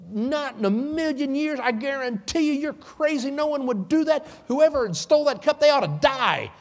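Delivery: high, loud, outraged protest; indignant denial. Acted. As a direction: swear it's impossible, voice up high. Brothers indignantly deny theft.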